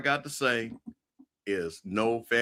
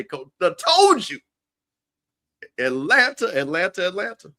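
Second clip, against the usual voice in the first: excited; 6 semitones higher, 4 dB louder than usual. Real, not acted. Hyped call for Atlanta.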